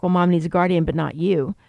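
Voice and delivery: ordinary talk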